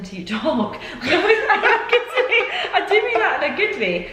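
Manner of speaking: laughing. Real, not acted. Laughing while teasing partner.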